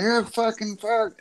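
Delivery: high, drawn-out mock cursing; imitated drunk teen. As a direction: mimic a drunk teen still going at it. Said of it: "They were still going".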